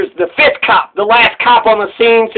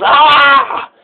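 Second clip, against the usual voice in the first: mild mock yell, pitched up; imitated pained yell; 5 semitones higher than usual. Retelling the man being hit, imitated pained yell. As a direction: imitate a last cry of pain, held back. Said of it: "and he yells".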